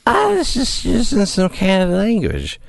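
put-on slurred, sloppy drunk talk. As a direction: slur it like you're hammered. Drunk voice quoting politician.

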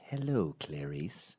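lightly voiced polite maniac, falling soft; Hannibal. Hannibal greeting Clarice, a hint of courteous menace. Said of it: "Like Hannibal with his whole".

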